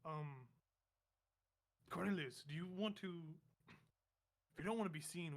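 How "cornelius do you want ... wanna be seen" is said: normal talking voice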